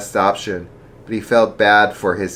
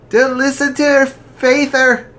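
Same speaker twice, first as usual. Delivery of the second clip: daughter voice; 6 dB louder than usual. Acted. Daughter warns father.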